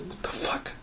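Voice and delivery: hushed, breathy disbelief under the breath; whispered what the fuck. Retold or imitated. Whispering to himself as his little brother stands over the bed, hushed. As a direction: whisper it so the brother won't hear.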